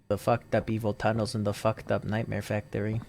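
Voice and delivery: ordinary talk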